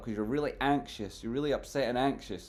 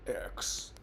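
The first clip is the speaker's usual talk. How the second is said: whisper; 8 dB quieter than usual. Real, not acted. Repeats the name X.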